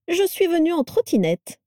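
high, beaming announcement; radiant delight. A narrator's reading. Vera telling how she travelled, radiant. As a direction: announce it proudly, pitch way up. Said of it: "déclara-t-elle rayonnante".

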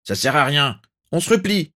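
high, urgent call to retreat; barked order. A narrator's reading. A Death Eater calls the retreat, high urgent bark. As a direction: bark it at your comrades. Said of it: "Un troisième aboya".

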